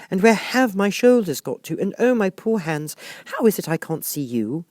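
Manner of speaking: put-on high girlish voice, puzzled; a worried child looking for her hands; high young girl voice; little girl Alice. A narrator's reading.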